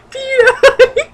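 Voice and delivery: high, loud, giggling peni; laughing. Real, not acted. Reader cracking up at the word peni, squealing with laughter. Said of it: "it's just a really funny word".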